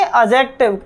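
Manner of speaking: "एडजेक्टिव" said a-jek-tiv, falling; silent D. Shown as a demonstration. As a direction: go straight from A to J in "एडजेक्टिव". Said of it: "उसको कभी नहीं बोला जाता". ("एडजेक्टिव" में ड silent)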